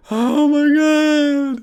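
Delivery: high, long wailed exclamation; drawn-out wail. Real, not acted. Reliving the friend's scare, high drawn-out wail. Reacting to own story.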